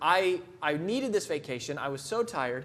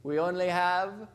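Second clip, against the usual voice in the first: put-on Jamaican accent; slower than usual. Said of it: "and he goes".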